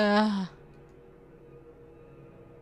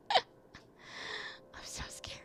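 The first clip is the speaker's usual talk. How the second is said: very slow, breathy laughing whisper; 8 dB quieter, much slower than usual. Real, not acted. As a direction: whisper the fear with a giggle.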